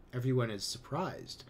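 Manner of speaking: plain reading voice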